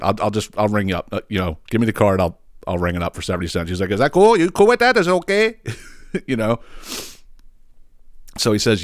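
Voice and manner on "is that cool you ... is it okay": high, loud broken-English store worker. Retold or imitated. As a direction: placate in accented broken English, voice pushed up. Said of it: "he spoke as broken English as the lady".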